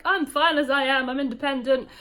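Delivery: retold declaration of independence, loud and high; defiant retort. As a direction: insist on it, imitating your own stubborn reply. Retold retort to mum's nagging.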